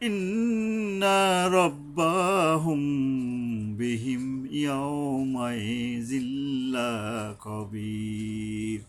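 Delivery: slow, drawn-out chanted recitation; Quran recitation. Shown as a demonstration. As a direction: intone each word, stretching the vowels. Recites final verse.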